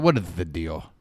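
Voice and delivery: normal talking voice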